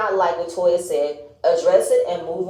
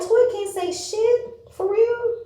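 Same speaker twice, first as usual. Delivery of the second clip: incredulous; 13 semitones higher, slower than usual. Real, not acted. Outraged defense of Toya.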